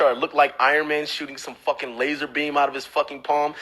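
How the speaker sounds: normal talking voice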